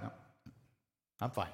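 barely audible, low and closed-off; withdrawn refusal. Acted. Husband bottling up anger refuses wife's offer to talk, withdrawn. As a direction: shut the question down very softly. Acted shutdown when angry with wife.

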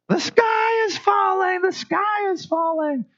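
high, slow panicked squawk of the sky falling; Chicken Little panic. Retold or imitated. Preacher mimicking Chicken Little for the congregation, high panicked cry. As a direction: cry it out like a frantic cartoon chicken. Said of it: "it's Chicken Little".

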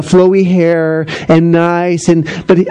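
ordinary talk, nothing marked

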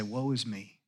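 low, soft, broken confession; hushed woe-is-me; quiet lament. Retold or imitated. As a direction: say it softly, undone before God. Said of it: "Like Isaiah, I say".

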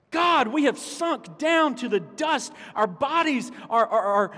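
high, loud, desperate crying out; screaming to God. Acted. Pastor reading Psalm 44 as a scream at the sky, desperate yelling. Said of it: "and screaming".

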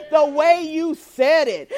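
mock the offended friend, leaning hard on every word; exaggerated indignation. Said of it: "You know how we do it?".

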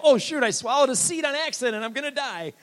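hammed-up panic, very high and loud; mock panic. Preacher mocks congregation's alarm over a swallowed seed, mock panic. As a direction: overact a terrified cry of impending doom. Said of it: "acted like I was dying".

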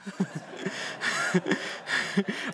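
airy unvoiced chuckle; a soft, amused huff of laughter; breathy laugh. Real, not acted. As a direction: laugh at yourself under your breath. Laughing at own story.

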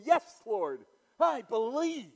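loud, high "Yes, Lord, I believe"; emphatic declaration. Retold or imitated. Martha answering Jesus, a loud emphatic yes. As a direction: proclaim it with full conviction. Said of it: "she said to him".

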